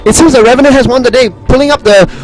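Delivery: fast, high, breathless commentary; excited play-by-play. Acted. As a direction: call the big fight like a caster. Live play-by-play, huge battle.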